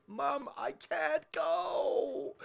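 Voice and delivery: put-on high, loud, drawn-out child's wail; mock yell. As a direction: yell across a parking lot like a desperate kid. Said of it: "and I yelled at her".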